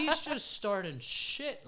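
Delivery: high, loud, drawn-out laughing exclamation. Real, not acted. Dad joking about his son picking fights, laughing. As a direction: crack up while saying it, stretching the words.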